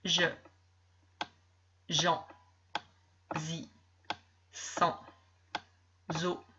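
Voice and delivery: put-on slow, careful reading of syllables to a child. Shown as a demonstration. Teacher reading syllables aloud to a young pupil, slow and clear. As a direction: read out each syllable clearly, one at a time.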